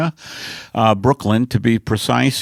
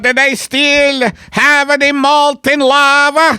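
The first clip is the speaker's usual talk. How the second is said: put-on loudly drawn-out Italian accent; Italian alien; 12 semitones higher, 6 dB louder, much slower than usual. Retired alien greets newcomer Luigi, Italian alien voice. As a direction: greet a fellow alien in broad mock Italian. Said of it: "retirement community for Italian aliens".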